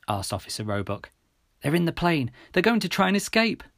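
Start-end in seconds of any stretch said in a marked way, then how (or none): 1.6-3.6 urgent, higher-pitched call; a mild shout, pitched up with urgency. A narrator's reading. Telling officer the gang is escaping, urgent mild shout. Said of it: "I shouted".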